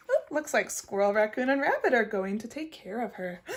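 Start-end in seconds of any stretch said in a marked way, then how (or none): none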